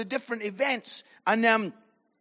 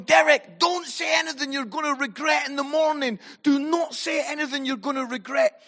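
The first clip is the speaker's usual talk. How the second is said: high, raised, insistent plea; retold urgent warning; 6 semitones higher, 3 dB louder than usual. Saying to Derek at the fence, urgent high warning. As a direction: plead with a friend before they blow up.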